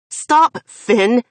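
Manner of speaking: high, loud desperate plea; pleading. Acted. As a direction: beg him to stop. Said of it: "I'm pleading with him".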